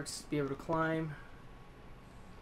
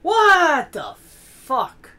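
very loud, higher, slow drawn-out outrage; furious outburst; 11 semitones higher, 14 dB louder, much slower than usual. Real, not acted. Gamer yelling at enemies, furious outburst. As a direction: let the anger explode.

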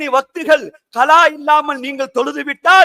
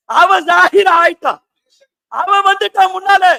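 raised, insistent sermon voice; fervent preaching; 5 semitones higher than usual. Real, not acted. Preacher declaring that God is manifest, fervently. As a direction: proclaim it to the whole gathering.

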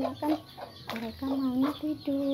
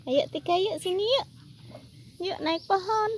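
higher sing-song coaxing with rising yuk; coaxing pet talk; 6 semitones higher, 3 dB louder than usual. Acted. Urging her squirrels to climb the tree, higher sing-song coax. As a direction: coax them up like a fond owner.

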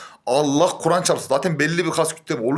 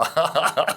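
short laugh. Real, not acted. Laughs at own joke.

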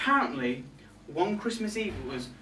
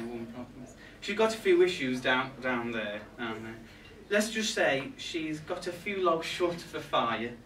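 ordinary talk

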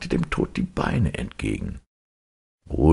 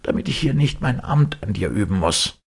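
stern Death; 7 semitones higher than usual. Acted. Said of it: "sprach der Tod ernst".

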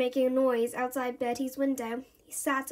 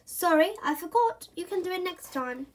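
higher, lightly apologetic mum voice; breezy mum; 5 semitones higher than usual. Acted. Mum apologises to Bertie on her way upstairs. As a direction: brush it off kindly.

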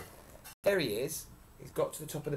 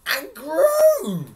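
very high, loud cry; explosive climax; 16 dB louder than usual. A narrator's reading. Storyteller topping the bean sprout's growth, crying out. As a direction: burst out suddenly on the last repeat.

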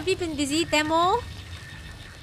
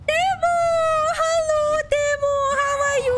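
excited greeting; 11 semitones higher than usual. Real, not acted. Greeting chat viewer.

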